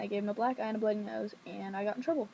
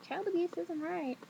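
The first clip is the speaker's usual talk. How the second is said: higher-pitched retold fretting; mock fussy outcry; spazzing; 7 semitones higher than usual. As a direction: mimic someone spazzing, pitch up. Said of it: "like, spazzing".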